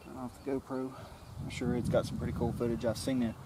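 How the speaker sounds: normal talking voice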